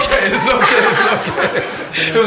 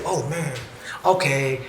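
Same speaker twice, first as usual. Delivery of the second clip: soft, low, receptive reply; acted understanding; 7 semitones lower, 9 dB quieter, slower than usual. Speaker showing how he could hear out another's experience, soft understanding. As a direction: take it in gently, voice dropped. Acts himself listening with understanding.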